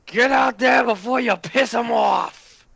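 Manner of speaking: ordinary delivery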